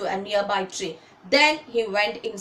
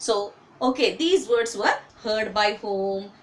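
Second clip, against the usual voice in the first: normal talking voice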